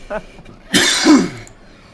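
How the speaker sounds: loud strange chuckle in a different voice; mysterious knowing laugh; eerie laugh. Acted. Gaia reacts to the coin.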